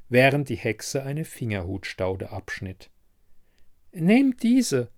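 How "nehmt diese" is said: high witch voice, urging; high-pitched crone's advice; old herb witch. A narrator's reading. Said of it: "riet sie ihrem Besucher".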